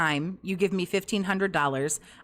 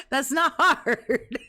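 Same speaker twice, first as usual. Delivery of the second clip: drawn-out, higher, through laughter; amused, stretched-out scoff breaking into laughter; laughing; 5 semitones higher, 3 dB louder, much slower than usual. Real, not acted. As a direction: laugh through the words, slowly. Laughing at how easy it is.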